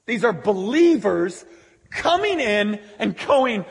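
loud, high, driving sermon voice; full-force preaching. Acted. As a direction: preach it out to the whole room.

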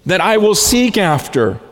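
high and loud, pouring out longing; fervent prayer. Retold or imitated. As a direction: plead with God, swept up and passionate. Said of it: "He says".

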